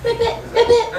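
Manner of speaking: high squeaky ribbits; baby frog croak. Acted. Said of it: "Baby Ribbit".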